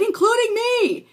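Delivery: slow, loud, high shout. Retold or imitated. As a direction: yell it to the whole family. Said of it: "I said".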